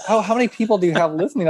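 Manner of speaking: a friend quizzing him quickly; curious friends. Retold or imitated. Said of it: "They're like".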